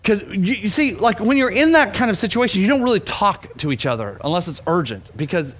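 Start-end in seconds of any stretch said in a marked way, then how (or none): none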